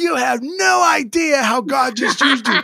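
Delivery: high, slow, near-shouted insistence; emphatic exclaiming. Retold or imitated. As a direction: tell her she has no idea, voice soaring. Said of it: "And Greg's like".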